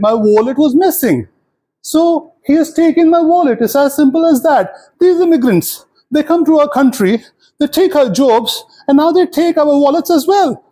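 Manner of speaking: put-on high-pitched indignant old lady; high, aggrieved elderly lady voice. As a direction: rattle off a complaint in a high old-woman voice. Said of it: "She kept on talking and talking".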